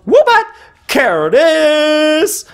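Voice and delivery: high, exclaimed card names; playful excitement. Real, not acted. Collector revealing cards from the pack, playfully excited. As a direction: call out each name with a high flourish.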